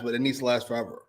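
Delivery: ordinary talk, nothing marked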